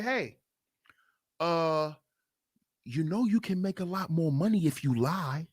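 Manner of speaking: soft, low tempting whisper-like voice; sly devil. Retold or imitated. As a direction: lure him in quietly like a tempter. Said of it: "This is how the devil talk".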